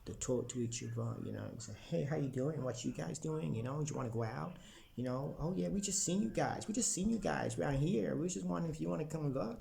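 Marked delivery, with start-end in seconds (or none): 1.9-9.6 upbeat, higher imitation of a friendly American; chirpy mock American greeting, pitched up; friendly American accent. Mimicking Americans greeting strangers, higher and upbeat American accent. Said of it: "The American accent's a more friendly accent".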